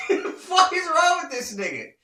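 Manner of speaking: high, loud, real laughter in the words; laughing disbelief. Real, not acted. Storyteller amused by the rival's question, laughing incredulously. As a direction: crack up while saying it.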